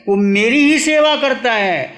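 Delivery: loud, high-pitched declaration; emphatic proclamation. Acted. Preacher quoting Lord Mahavir's answer to devotees, emphatic proclamation.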